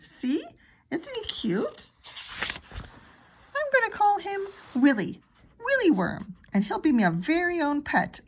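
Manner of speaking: put-on high, sweet, eager child voice; small brother gushing over his new pet worm; little boy Fudge. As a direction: coo like a proud toddler.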